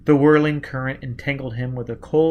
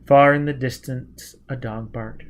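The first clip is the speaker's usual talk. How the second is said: ordinary delivery, nothing marked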